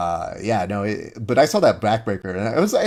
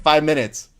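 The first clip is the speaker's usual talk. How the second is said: louder, higher mock yell; raised-voice retold shout; imitated time call; 6 semitones higher, 5 dB louder than usual. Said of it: "as I was yelling out time".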